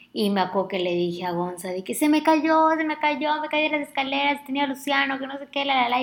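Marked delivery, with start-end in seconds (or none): 1.9-6.0 high, loud, frantic rush of words; panicked retelling. Retold or imitated. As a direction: relive the scare, voice jumping up. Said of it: "yo me súper asusté". (contando con pánico, voz aguda y atropellada)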